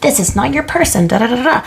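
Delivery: high, brushing it off flatly; blunt dismissive verdict. Pretend. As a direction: say it like a blunt know-it-all. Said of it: "I never say these things where I'm like".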